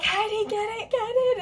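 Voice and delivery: high, frantic cheering; mock chorus of eager friends; excited urging. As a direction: push her to grab it now. Group cheering her to buy.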